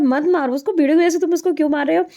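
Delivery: high, quick scolding, half amused; half-laughing scold. Retold or imitated. Telling her cousin to stop hitting the lazy kid, a quick high half-laughing scold. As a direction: tell him off fast while stifling a laugh.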